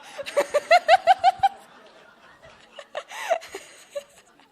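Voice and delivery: long high-pitched laugh, loud and free; loud burst of laughter. Real, not acted. Laughing at joke.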